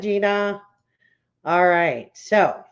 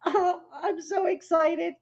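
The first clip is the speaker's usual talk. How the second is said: much higher, laughing and half crying through the words; laughing excitedly; 10 semitones higher than usual. Real, not acted. Host laughing at her own excitement, laughing. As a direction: giggle it out, overjoyed.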